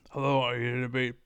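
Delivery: quick, loud mimicked greeting; rushed imitation of an opening question; imitating a caller. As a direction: rattle it off loudly, mimicking the caller. Re-enacts the clip to show mistake.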